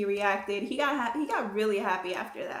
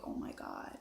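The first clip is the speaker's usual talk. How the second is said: whispered; 13 dB quieter than usual. Retold or imitated. Alone in bathroom, nervous.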